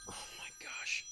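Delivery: breathy under-the-breath oh my gosh before picking up; whispered aside. Real, not acted. Host whispering to himself before answering his mother's call, real whispered aside. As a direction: whisper it to yourself, dreading the call.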